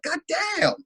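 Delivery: high-pitched exclamation of shock; startled reaction. Retold or imitated. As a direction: relive the shock of seeing it. Retold reaction to knockdown.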